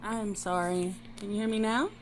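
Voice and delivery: very faint, distant voice; too far from the microphone; barely audible. Real, not acted. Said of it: "your voice is very quiet".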